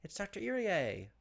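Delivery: high, drawn-out hail; excited announcement. Pretend. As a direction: announce the rescuer with playful fanfare. Greets the rescuer's arrival.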